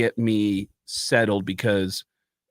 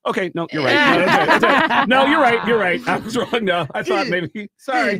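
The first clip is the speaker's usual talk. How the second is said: high, louder, laughing backtrack; flustered backpedal; 10 semitones higher, 5 dB louder than usual. Retold or imitated. Comedian backing down to the strippers, high flustered laugh. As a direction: babble the apology nervously.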